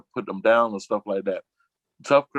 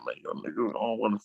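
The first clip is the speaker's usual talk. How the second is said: hushed quoted friend; 8 dB quieter, faster than usual. Retold or imitated. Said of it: "be like".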